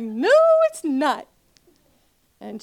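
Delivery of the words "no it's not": high loud denial. Retold or imitated.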